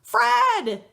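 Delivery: put-on boy crying out to his lost dog. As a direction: shout the name in delight. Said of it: "he cries".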